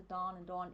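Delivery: Don as long open DAHN, close to Dawn; lengthened American vowels. Shown as a demonstration. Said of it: "lengthening the vowels out to".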